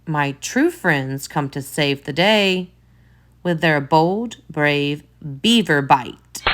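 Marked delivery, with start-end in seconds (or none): none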